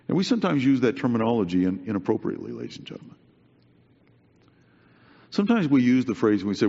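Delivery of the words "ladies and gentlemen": whispered aside. Real, not acted. Aside to the congregation.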